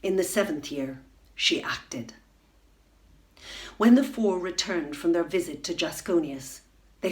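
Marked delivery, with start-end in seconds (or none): 1.3-2.1 hushed. A narrator's reading. Ominous narration beat.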